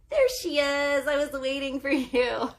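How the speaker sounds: loud, high, hammed-up greeting; theatrical high-pitched holler of welcome; playful mock welcome. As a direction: call out as if thrilled someone finally showed up. Acted welcome to someone arriving.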